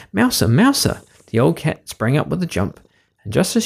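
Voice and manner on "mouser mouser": high, carrying call. A narrator's reading. Cook calls the cat again, raised call. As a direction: call her name across the room. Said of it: "And the cook called again".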